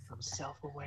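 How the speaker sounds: breathy whispered repeat. Shown as a demonstration. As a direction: whisper it to yourself.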